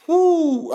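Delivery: high, loud blown-out whew; mock exhausted whew. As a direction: blow out a big overwhelmed whew. Overwhelmed by early texts.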